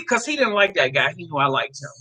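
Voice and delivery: usual speaking voice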